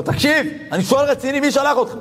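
angry demand. Retold or imitated. Gruff recluse demands who sent the rabbi, angrily. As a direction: press him hard, suspicious and annoyed. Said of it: "למה אתה כועס".

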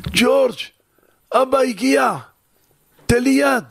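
desperate father calling into the hospital room; father calling his son. Acted. Father calls wounded son in hospital.

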